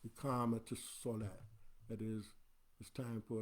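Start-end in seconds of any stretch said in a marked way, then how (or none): none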